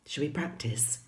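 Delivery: ordinary delivery, nothing marked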